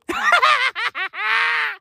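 a high-pitched burst of laughter. Real, not acted. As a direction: cackle up high at your own joke. Laughs at his own joke.

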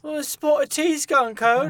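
hammed-up British accent, mocking. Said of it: "Such a British thing".

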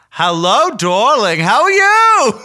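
high, slow, loud camp greeting; dad's voice. Retold or imitated. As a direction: imitate his dad sing-song. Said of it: "he speaks like".

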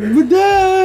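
put-on high loud whiny child crying; fake crying. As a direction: wail it like a crying kid. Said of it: "made him cry even worse".

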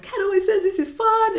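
very high, loud retold complaint; mock exclamation. Retelling her complaint about Kat on the ride, mock high-pitched exclamation. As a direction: pitch it way up, loudly, as a re-enacted protest.

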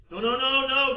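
loud, high, rapid-fire protest; alarmed denial. Acted. Brothers accused of being spies, protesting loudly. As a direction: blurt out an urgent denial.

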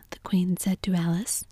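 plain reading voice

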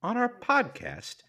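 very high-pitched mock indignation; mock scandalised. Host complains his co-host is laughing, mock scandalised. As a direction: pretend to be appalled, voice way up high.

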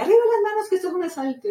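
high-pitched joking holdup voice, lightly voiced; teasing friend. Friend behind her on the ride, teasing in a high mock robber voice. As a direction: play the joker mocking a robbery.

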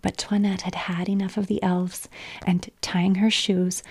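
usual speaking voice

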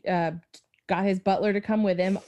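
normal talking voice

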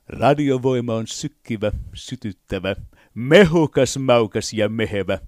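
slow, booming radio announcer; showy radio-jingle read. Shown as a demonstration. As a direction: sell it like a radio promo. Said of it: "kuinka ylös ja kuinka matalalle pääsee".

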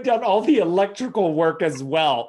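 real laughter breaking into the words; amused, can barely get it out; laughing. Real, not acted. Host laughs at the story.

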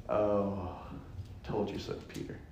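a sly aside to Peter, breathed; whispered told-you-so. Retold or imitated. Jesus teasing Peter in a sly whisper. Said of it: "Even more".

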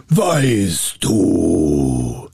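low, menacing giant; dreadful booming spirit; terrible spirit voice. A narrator's reading. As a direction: draw it out like a fearsome spirit. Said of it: "rief er mit fürchterlicher Stimme".

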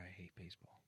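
whispered, barely voiced admission; hushed confession. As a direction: confess it under your breath. Confesses before saying it louder.

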